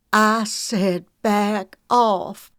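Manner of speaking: slow, loud and hard, every word weighed; firm warning. Acted. Sister warns Frank with the shotgun aimed, firm slow threat. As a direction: warn him off without budging.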